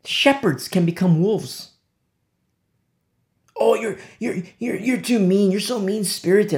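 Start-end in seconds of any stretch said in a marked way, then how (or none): 3.5-6.6 higher, whiny imitation of complainers; mock the offended listener; mocking critics. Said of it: "I've heard it".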